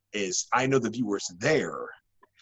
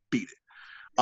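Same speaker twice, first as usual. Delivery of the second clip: breathy whisper of a challenge; whispered taunt; whispered dare; 5 dB quieter than usual. Real, not acted. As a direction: hiss the dare under his breath. Challenging rivals to beat his promo.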